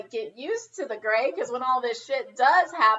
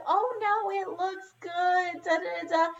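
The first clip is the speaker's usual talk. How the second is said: higher mock-sweet stylist voice; mock soothing stylist; 7 semitones higher than usual. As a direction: parody someone talking you down sweetly. Stylist soothing her, mocked.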